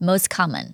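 "most common" as mos-common; dropped T. Shown as a demonstration. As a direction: drop the t of most, no gap. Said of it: "ลบเสียง T นะคะ". (ลบเสียง t ใน most common)

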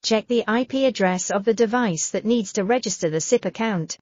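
ordinary talk

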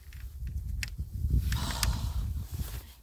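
long deflated breathy sigh; long heavy sigh. Real, not acted. Long heavy sigh after losing the biggest fish. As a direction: sigh out the disappointment.

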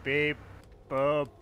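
low monotone beep-boop; spoken computer noises, evenly spaced; robot beeps. Pretend. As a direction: play a robot beeping. Robot sound effects in play.

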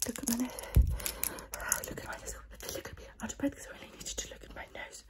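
close-mic whisper, guiding the listener; ASMR whisper. Acted. Whispering to the viewer during an ASMR roleplay.

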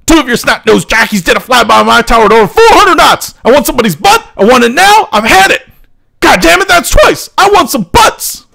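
high, loud outraged ranting; furious complaint. Acted. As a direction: blow up demanding someone's butt. Furious tower officer complains.

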